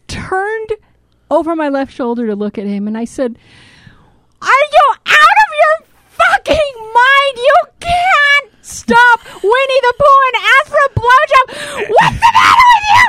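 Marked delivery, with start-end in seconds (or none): 4.4-13.1 high, loud, outraged screech; retold outraged shriek at husband; furious shriek. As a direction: scream it at him, voice cracking high. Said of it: "My voice went into a whole other level".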